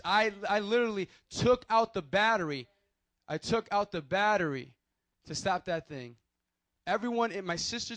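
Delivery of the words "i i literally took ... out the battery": louder, pressing each word hard; emphatic insistence. Real, not acted. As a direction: insist it really happened. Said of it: "I'm serious, I'm serious".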